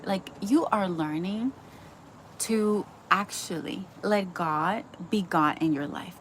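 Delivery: ordinary talk, nothing marked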